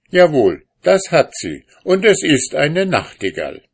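laut und höher, stur; trotziger Ehemann. A narrator's reading. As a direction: trotzig beharren. Streit eskaliert. (aufgebrachter Mann beharrt im Streit)

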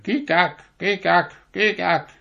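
high, quacking duck sounds; high ducks chattering gleefully. A narrator's reading. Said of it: "die Enten schnatterten".

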